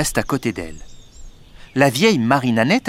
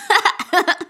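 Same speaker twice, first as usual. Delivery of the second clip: put-on a boy's high giggle; pushed by his father, high giggling laugh. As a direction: laugh up high like a tickled boy. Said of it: "et Poil de Carotte rit".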